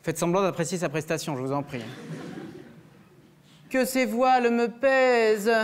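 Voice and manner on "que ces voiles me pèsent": slow, high, declaiming tragic verse; hammed-up classical tragedy line; tragic Phèdre. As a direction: overblown theatre declamation. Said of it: "C'est Phèdre de Racine".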